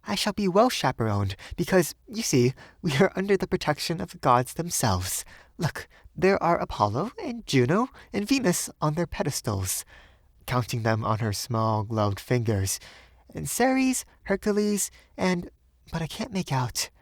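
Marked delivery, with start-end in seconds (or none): 0.0-9.8 high, playful woman's voice; high, brightened, listing lilt; smiling young Frenchwoman. A narrator's reading. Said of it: "she smiled". 13.3-16.9 very high woman's voice, counting off names; high, halting lilt; playful young Frenchwoman. A narrator's reading.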